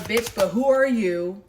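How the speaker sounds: loud, affronted challenge; confrontational main female. Acted. Main female confronting the mistress, loud and affronted. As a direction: demand it loudly, taking offense. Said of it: "main female is gonna be like".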